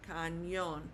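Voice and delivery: "cañón" as ka-NYON, weight on the final O; Spanish "cañón", second syllable stressed. Shown as a demonstration. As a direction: say "cañón" with a ny sound and stressed ending. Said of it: "putting the stress on that O".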